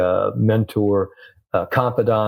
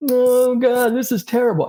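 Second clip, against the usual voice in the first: mock despair. Golfer sitting there dwelling on a bad shot, mock despair. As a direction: wail it like a hammed-up sulking golfer.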